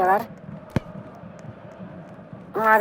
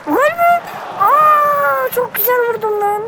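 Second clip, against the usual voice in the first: real thrilled yelp over his shot; excited disbelief; 9 semitones higher, slower than usual. Real, not acted. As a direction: shriek it out as the shot flies in. Own shot, near goal.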